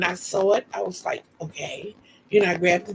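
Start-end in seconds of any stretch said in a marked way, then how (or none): 1.4-2.0 low, hushed, matter-of-fact; calm acceptance. Retold or imitated. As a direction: quietly take it in stride. Acting calm on seeing own wound.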